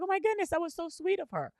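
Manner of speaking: put-on high, fast gushing voice, quoting the girlfriend. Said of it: "She was like".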